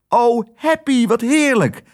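hoge, blije uitroep; opgetogen. Acted. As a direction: roep het verrukt en hoog uit. Otje hoort heerlijk nieuws. (blij en opgelucht)

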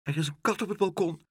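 high, fast, alarmed voice; anxious cry. A narrator's reading. Pigeon warning Aagje about the cat, anxious cry. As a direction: blurt out a frightened warning. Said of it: "riep Dolly angstig".

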